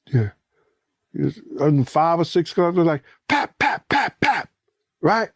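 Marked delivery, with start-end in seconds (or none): 3.3-4.5 high popping pap sounds; quick mouth gunshot pops; imitated gunshots. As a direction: mimic the gunfire.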